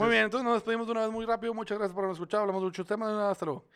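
rushed goodbye. Real, not acted. Rushing the goodbye.

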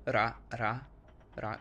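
ra with a Thai R; Thai R sound. Shown as a demonstration. Teaching the Thai R sound. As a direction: say ra, ra, ra with a light tap. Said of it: "the R sound".

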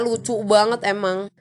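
ordinary talk, nothing marked

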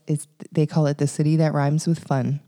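ordinary talk

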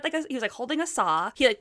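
ordinary talk

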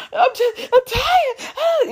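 imitating crying yell. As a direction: wail it like a sobbing friend at full volume. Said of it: "at the top of her lungs".

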